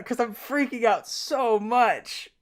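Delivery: real flustered excitement, slow and emphatic; freaking out. Real, not acted. Fan telling how they woke their roommate, freaking out. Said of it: "I guess you can tell".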